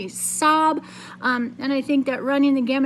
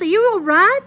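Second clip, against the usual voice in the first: louder, worried question; scared; 7 semitones higher, 7 dB louder than usual. Acted. Mother checking on her son, scared voice. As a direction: ask fearing he's hurt. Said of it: "Mama asked in a scared voice".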